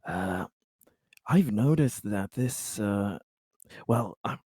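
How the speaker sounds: soft, low, halting businessman voice; hesitant corporate type. Acted. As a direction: feel out a discreet business pitch, haltingly. Plays Conrad, a corporate buyer.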